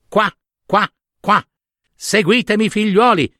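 high, quacking call to the brood; mother duck calling. Acted. Mother duck to her ducklings in the water, calling high.

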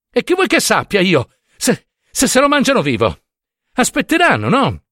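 very high, irritated comeback; acted high-pitched exasperation; exasperated retort. A narrator's reading. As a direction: answer back as if fed up. Husband snapping back in acted quarrel.

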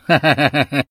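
a short scornful laugh from the leopard; mocking laugh. A narrator's reading. The leopard sneers at the monk with a scornful laugh. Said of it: "con una risata di scherno".

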